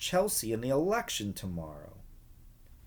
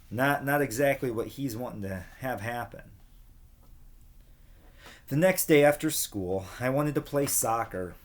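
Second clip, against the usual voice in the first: plain reading voice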